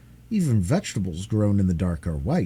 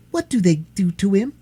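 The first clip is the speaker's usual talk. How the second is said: trembling voice; 11 semitones higher, 5 dB louder than usual. Acted. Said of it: "asked Irene with a trembling voice".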